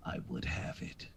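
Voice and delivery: low, sinister whispered villain; menacing whisper. Acted.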